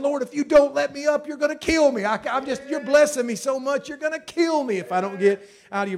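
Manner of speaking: loud, high ecstatic cry; blessed past bearing, crying out; overwhelmed joy. Retold or imitated. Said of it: "we end up saying".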